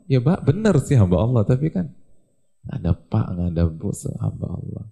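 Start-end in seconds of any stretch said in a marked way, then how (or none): none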